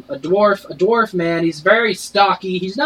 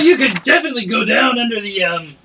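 raspy barkeep; 5 semitones higher than usual. Acted. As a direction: rasp it out like a sick barkeep. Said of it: "Your voice".

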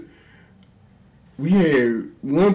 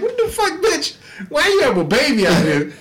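incredulous; 10 semitones higher than usual. Retold or imitated. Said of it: "I'm like".

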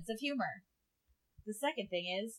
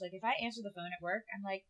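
ordinary talk, nothing marked

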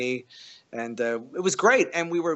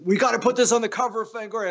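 eager rush; 8 semitones higher, 7 dB louder, faster than usual. Retold or imitated. Host excited about the painting.

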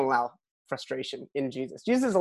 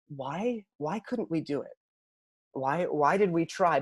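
hushed question; 6 dB quieter, slower than usual. Retold or imitated. Disciples ask privately.

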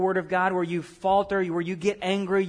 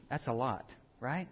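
usual speaking voice

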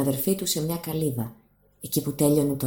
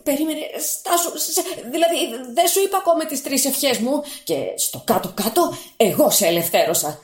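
nervous bargaining; 10 semitones higher, 4 dB louder than usual. Acted. Fisherman bargains with genie.

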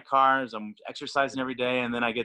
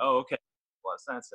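retold reaction trailing into a whisper; whispered aside; 2 semitones higher than usual. Repeating what he heard back, ending in a whisper. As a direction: drop to a breathy whisper on the last words.

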